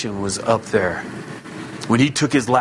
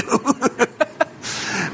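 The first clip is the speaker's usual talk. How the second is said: high laugh; 12 semitones higher than usual. Real, not acted. Laugh after his joke.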